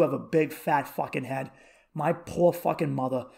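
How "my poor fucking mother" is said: ordinary talk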